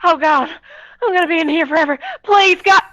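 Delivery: hammed-up terror of being stuck; panicked plea. Trapped sprite wails to God she's stuck forever, mock panic.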